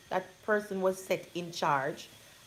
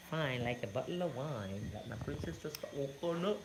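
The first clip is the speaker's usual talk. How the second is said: soft playful coo; 4 semitones lower, 7 dB quieter than usual. Real, not acted. Cooing to baby daughter.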